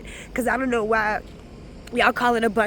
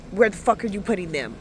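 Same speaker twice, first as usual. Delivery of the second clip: ordinary delivery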